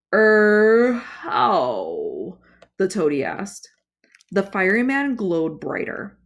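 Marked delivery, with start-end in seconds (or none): 0.1-2.4 nervous croaking er; timid monster falters; hesitant toad creature. Acted.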